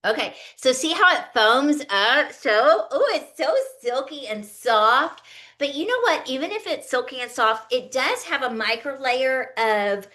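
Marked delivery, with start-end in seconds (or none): none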